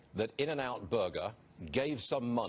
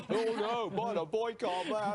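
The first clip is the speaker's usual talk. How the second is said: high-pitched, laughing mock outrage; mock horror; 10 semitones higher than usual. Commentator ridiculing a burger boycott over Bible verses, mock horror. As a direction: wail it in sarcastic alarm, voice climbing high.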